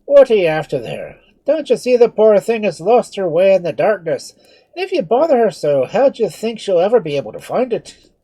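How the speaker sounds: loud, high scolding friar; angry Irish brogue. Acted. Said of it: "the father's deep, rich brogue".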